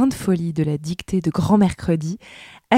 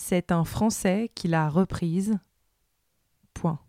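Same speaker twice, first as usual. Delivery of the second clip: dictating; 6 dB quieter than usual. Acted. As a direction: read it out like a school dictée, word by word. Dictée read aloud with punctuation.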